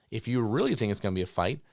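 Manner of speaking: usual speaking voice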